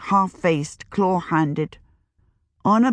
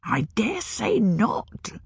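slow breathy crone whisper; old witch whispering; 4 semitones higher, 3 dB quieter, slower than usual. A narrator's reading. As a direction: hiss it softly like an old witch. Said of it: "said the witch".

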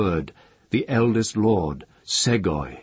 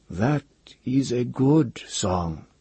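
slow, higher, unsure praise; uncertain voice; 5 semitones higher, slower than usual. A narrator's reading. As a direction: hesitate as you praise it. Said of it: "His voice was uncertain".

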